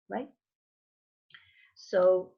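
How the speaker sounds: usual speaking voice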